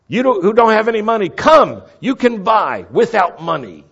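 high, urgent shouted invitation; loud calling out. Acted. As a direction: call the crowd over loudly. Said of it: "this loud calling out".

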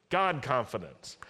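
usual speaking voice

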